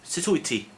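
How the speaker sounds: teacher's example of Sut wyt ti; Welsh pronunciation. Shown as a demonstration. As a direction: model Sut wyt ti clearly, stress on wyt. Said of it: "That's sort of less formal".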